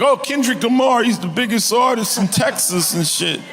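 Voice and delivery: high, loud mocking voice; hammed-up gushing fan. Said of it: "They're like".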